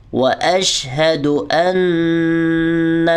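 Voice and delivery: واشہد ان with a long nasal hum on the ن; nasal ghunna. Shown as a demonstration. Teacher modelling ghunna. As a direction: hold a nasal ن in ان. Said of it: "یہاں پر غنہ ہوگا".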